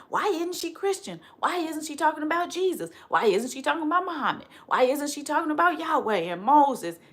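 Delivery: high mock-incredulous questioning; mock critics. Voicing religious critics.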